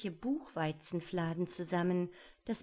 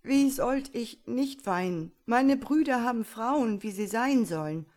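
louder, higher lament of a miserable prince; tearful Iwan; 4 semitones higher, 7 dB louder than usual. Acted. Iwan pleading to his father, loud tearful lament. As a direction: complain it out, close to tears. Iwan weeps over frog bride.

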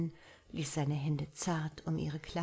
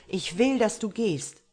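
raised, louder and higher demand; insistent; 5 semitones higher, 8 dB louder than usual. A narrator's reading. Marlowe orders Violet to go, raised and loud. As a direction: push the words out with force.

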